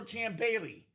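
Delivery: usual speaking voice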